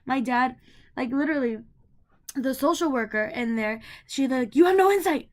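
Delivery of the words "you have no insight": high, loud mock yell. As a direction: snap it like a stern social worker. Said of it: "she yelled at me".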